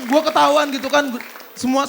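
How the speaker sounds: ordinary delivery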